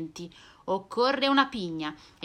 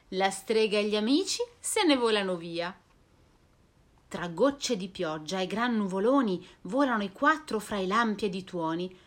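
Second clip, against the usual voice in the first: plain reading voice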